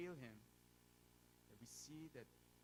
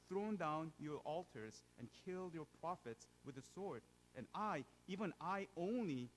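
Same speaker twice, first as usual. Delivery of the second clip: louder, raised reading voice; scripture reading; 3 semitones higher, 7 dB louder than usual. Acted. The preacher reads Elijah's cave story aloud, proclaiming. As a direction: proclaim the verses to the congregation.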